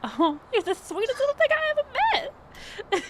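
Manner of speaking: very high, fast, gushing perky voice; bubbly HR lady. Acted. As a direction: chirp it out like an overeager hostess. GM keeps playing Becky.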